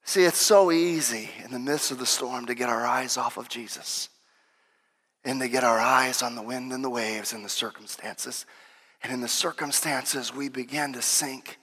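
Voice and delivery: low, soft, reflective sermon voice; hushed preaching. Real, not acted. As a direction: bring the sermon down to a quiet, solemn register.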